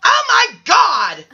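high, drawn-out cry of exasperation; exasperated host. Acted. Host reacting to bachelor one's repeated answer, exasperated shout. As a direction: wail it slowly, fed up.

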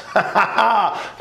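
high, falling laugh; joyful laugh. Real, not acted. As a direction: burst into a laugh of praise. Laughs before giving praise.

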